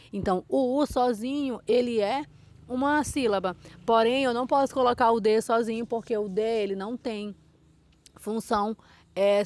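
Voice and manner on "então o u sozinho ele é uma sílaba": ordinary delivery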